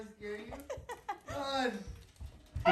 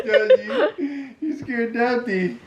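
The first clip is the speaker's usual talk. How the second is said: laughing; 6 semitones higher than usual. Real, not acted. Dad laughing at toddler.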